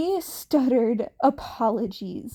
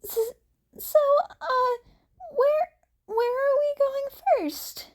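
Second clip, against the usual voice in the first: nervous stammer. A narrator's reading. Nervous Izuku asks.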